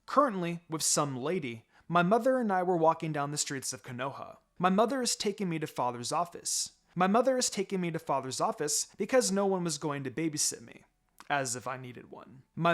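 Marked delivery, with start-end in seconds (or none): none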